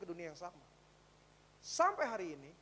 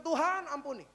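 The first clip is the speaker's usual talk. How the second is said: higher, louder pleading prayer; tearful plea; 6 semitones higher, 9 dB louder than usual. Retold or imitated. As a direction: beg for mercy with a lifted voice. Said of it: "Dia nangis, dia berdoa". (doa memohon ampun yang lebih tinggi dan keras)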